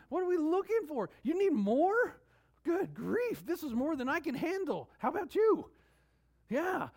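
high, loud exhortation; impassioned preaching. Real, not acted. Preacher amazed at how much God has said, impassioned. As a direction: push up high and loud, urging the congregation.

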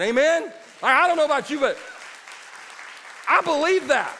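loud, high preacher's cadence; preaching at full force. Acted. Pastor rousing the church, loud preaching. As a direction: preach it out to the congregation.

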